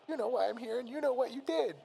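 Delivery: high-pitched wounded accusation; Teddy. A narrator's reading. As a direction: accuse in a high, tearful cartoon voice. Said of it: "a single tear streaming down his face".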